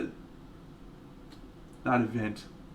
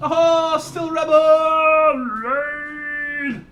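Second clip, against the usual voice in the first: very high, loud, drawn-out cheer; excited raid shout; 10 dB louder, much slower than usual. Real, not acted. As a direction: whoop the raid in slowly.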